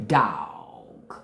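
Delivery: "dog" very low and drawn out; Southern pronunciation. Shown as a demonstration. Showing how to move the mouth, drawled "dog". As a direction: stretch "dog" into a deep Southern "dawg". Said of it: "That's how they say it down south".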